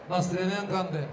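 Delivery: ordinary delivery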